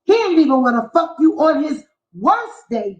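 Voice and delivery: ordinary delivery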